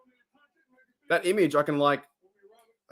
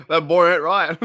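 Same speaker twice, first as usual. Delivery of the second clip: mock Hank Hill; 7 dB louder than usual. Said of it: "Hank Hill quotes".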